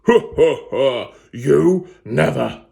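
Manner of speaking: loud, slow booming laughter; great mocking laugh. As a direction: laugh down at her from above. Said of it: "He laughed a great laugh".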